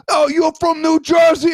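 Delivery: high, loud exaggerated New Jersey accent; hammed-up Jersey accent, nearly shouted; mock New Jersey accent. Host imitating people teasing him, loud mock New Jersey accent. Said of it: "just like when people talk".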